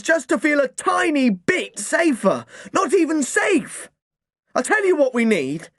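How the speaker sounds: put-on boy shouting his wish for calm; furious yell. A narrator's reading. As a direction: yell so the house hears. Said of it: "I didn't lower my voice".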